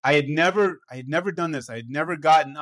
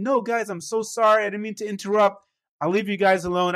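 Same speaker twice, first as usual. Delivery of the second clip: higher-pitched, pleading backpedal; flustered apology; 6 semitones higher than usual. Retold or imitated. Younger self apologizes to offended table, flustered. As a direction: re-enact an embarrassed, hasty apology.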